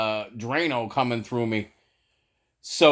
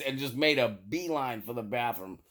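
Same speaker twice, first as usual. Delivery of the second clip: ordinary talk, nothing marked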